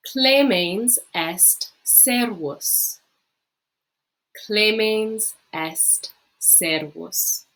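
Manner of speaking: slow, syllable by syllable, for the class to repeat; slow model reading. Shown as a demonstration. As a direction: read it out slowly so students can copy. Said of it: "practice saying it yourself".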